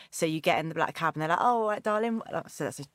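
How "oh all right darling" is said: a London cab driver's accent, lightly put on and pitched higher; hammed-up London cabbie greeting a passenger; playful imitation of a London taxi driver's accent; mock London cabbie accent. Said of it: "terrible accent".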